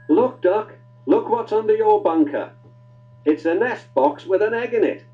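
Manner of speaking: high, loud excited call. Acted. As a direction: call him over, delighted. Crew calls Duck excitedly.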